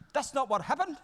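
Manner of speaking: high, loud protest; indignant Zoe. Retold or imitated. Zoe storming over to object, high and loud. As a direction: burst out in protest. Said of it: "She goes".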